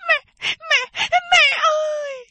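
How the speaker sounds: very high, drawn-out sobbing scream; a terrified girl shrieking for her mother; screaming and crying. A narrator's reading. Daughter at the window screaming for her mother, sobbing shriek. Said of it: "khóc thét lên".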